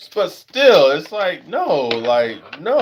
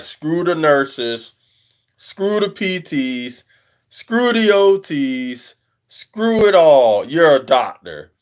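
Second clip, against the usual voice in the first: high, slow, building to a loud mock rant; mocking know-it-all patients; 6 semitones higher, 6 dB louder, slower than usual. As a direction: ham up the people who only see doctors. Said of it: "They, they like".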